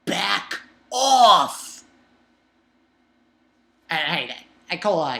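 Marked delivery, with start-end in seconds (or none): 0.1-1.8 loud, punched command; exasperated warning. Acted. Reader telling Cohen to lay off, loud exasperation. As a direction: push them away with a loud burst on the last word.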